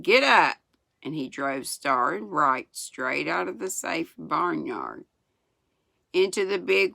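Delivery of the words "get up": higher, louder driving call; shouted command. A narrator's reading. Boy shouting at calves beside him, raised-voice command. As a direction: call out to get the team moving. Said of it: "cracked his whip and shouted".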